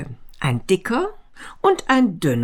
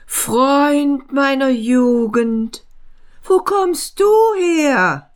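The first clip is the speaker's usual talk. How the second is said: slow, much higher astonished greeting; rising thrill of recognition; thin man greeting the friend of his youth; thin man amazed; 7 semitones higher, slower than usual. A narrator's reading. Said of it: "staunte der Dünne".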